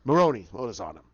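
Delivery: very low, grunted question; crime boss. Retold or imitated. Boss asking Maroni about the file, low. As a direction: drop to a low boss's voice.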